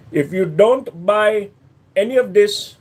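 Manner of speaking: slow, put-on Pakistani accent; attempted Pakistani accent. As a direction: spell it out as a mock Pakistani shopkeeper. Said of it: "I think that was Pakistani".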